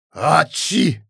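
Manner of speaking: loud, high acted sneeze; sudden sneeze burst; explosive sneeze. A narrator's reading. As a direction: sneeze it out at full volume. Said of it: "rief er da in den Hörer".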